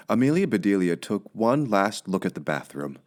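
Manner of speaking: plain reading voice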